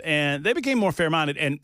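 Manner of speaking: ordinary talk, nothing marked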